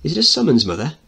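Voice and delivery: put-on high, loud little girl voice; young girl voice. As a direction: call out like an eager girl. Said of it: "Donna Marie speaking".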